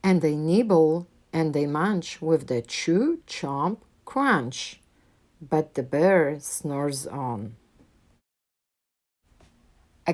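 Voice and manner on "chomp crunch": usual speaking voice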